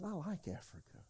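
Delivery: softly marvelling newcomer; delighted visitor. Retold or imitated. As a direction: gush over the view quietly. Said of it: "I said".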